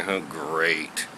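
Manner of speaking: lightly voiced unimpressed woman, low and soft; a hint of a dry seatmate. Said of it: "And the lady's like".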